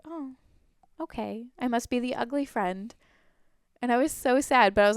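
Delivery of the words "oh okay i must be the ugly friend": small, higher, softer mock-dejected voice; retold sadness. Rejected friend talking to herself, mildly acted sadness. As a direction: act out a quietly deflated reply to oneself. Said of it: "like, so sad".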